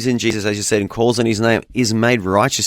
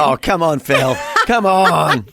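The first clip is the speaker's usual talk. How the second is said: teasing protest; 6 semitones higher, slower than usual. Real, not acted.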